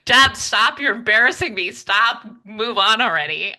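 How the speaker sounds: playful loud stop it, you're embarrassing me; mom's embarrassed protest. Retold or imitated. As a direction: act out a mother telling her daughter to quit gushing. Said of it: "And yes, she's".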